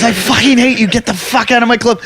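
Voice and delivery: high-pitched mock rant; imitating angry owner. Repeats the club owner's outburst.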